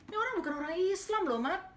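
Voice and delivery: high, loud and quick, insisting; a retold excited insistence to a friend; excited protest. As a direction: blurt out the suspicion eagerly. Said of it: "Gitu, saya bilang gitu".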